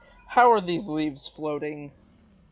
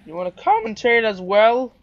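voice crack; 3 semitones higher, 6 dB louder than usual. Real, not acted. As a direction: let the voice crack mid-question. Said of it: "as a voice crack?".

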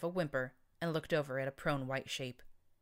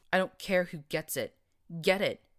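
loud, furious bellow; a cyborg bellowing at a cowering unicorn; 3 semitones higher, 7 dB louder than usual. Acted. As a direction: roar the order across the room. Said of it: "he bellowed".

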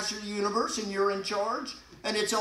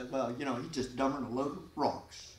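sarcastic; 7 semitones lower, 8 dB quieter than usual. Retold or imitated. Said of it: "we are sarcastic".

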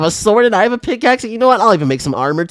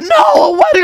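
horrified shout. Acted. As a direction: yell in disbelief. Realising mom plans a wedding.

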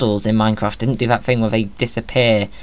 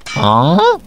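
goose voice; 7 semitones higher, 4 dB louder than usual. Acted. Plays a honking goose character.

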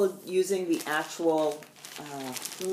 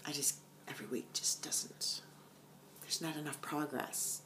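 whisper; 10 dB quieter than usual. Real, not acted. Whispered aside.

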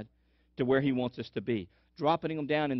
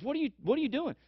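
much higher incredulous question; can't believe what he's seeing; bewildered servant; 12 semitones higher, faster than usual. Acted. Servant questioning Elisha pouring coffee, bewildered. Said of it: "the servant says".

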